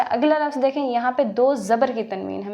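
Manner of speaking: ordinary talk, nothing marked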